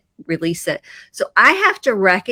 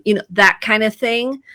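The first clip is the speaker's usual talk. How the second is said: usual speaking voice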